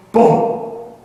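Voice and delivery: one loud, abrupt boom; imitated landing thud. Imitates landing after slide.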